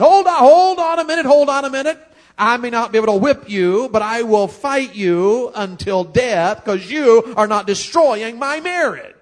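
loud, high, slowing defiant vow; Adam standing his ground. Pretend. Adam confronting the serpent, loud slow defiance. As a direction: plant your feet and shout him down, slow and firm.

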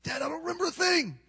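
high, anxious plea from a frightened son; a hint of panic, strained and high; panicked son. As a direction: voice the son's panic, pitched high. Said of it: "He's crying. He's panicked".

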